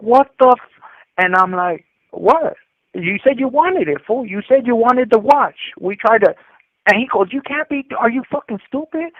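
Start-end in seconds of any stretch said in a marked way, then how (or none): 0.0-0.8 retold shock. 2.1-6.3 higher-pitched, acted self-justifying protest; defensive protest. Younger homie justifies himself to older homie, defensive retold protest. 7.3-9.1 high-pitched imitated disbelief; incredulous scolding. Older homie scolds the watch robber, high incredulous imitation.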